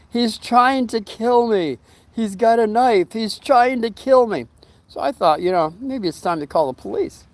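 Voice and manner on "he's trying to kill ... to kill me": retold scream. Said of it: "and she's screaming".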